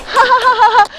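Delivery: high bursts of laughter; real high laugh. Real, not acted. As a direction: belly laugh out loud. Laughing in the rain.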